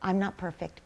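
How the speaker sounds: soft, gentle self-reassurance; forgiving yourself in a softened voice; self-compassion. Acted. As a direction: soothe yourself quietly. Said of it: "having compassion for yourself and just say".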